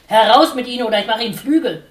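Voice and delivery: very loud, fast threat; furious shout. A narrator's reading. Dickhop bellows at the sparrow to get out.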